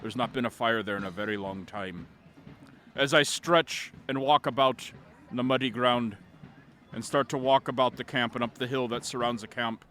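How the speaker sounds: low, measured eerie tale ending; solemn storytelling. A narrator's reading. Bard waking to an abandoned camp and graves, solemn. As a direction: drop low and let the discovery sink in.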